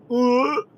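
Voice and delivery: high-pitched disgusted "ugh"; mock gagging. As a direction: fake a retch in disgust. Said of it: "initiate the gag reflex".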